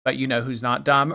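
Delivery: usual speaking voice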